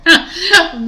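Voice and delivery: a high, loud burst of real laughter; loud laughing burst. Real, not acted. Reader cracks up mid-reading.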